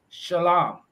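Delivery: shalom as "shuh-LAHM", slow, with an open A; proper pronunciation. Shown as a demonstration. Teaching how to say peace in Hebrew, shalom pronounced "shuh-LAHM". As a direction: stretch the final "lahm" of shalom. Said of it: "educated on the proper pronunciation".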